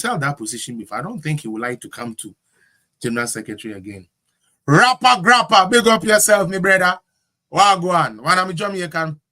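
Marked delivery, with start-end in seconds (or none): 4.7-9.2 big him up at full volume; hyped shout-out. Real, not acted. Host hailing a Jamaican guest on the show, hyped.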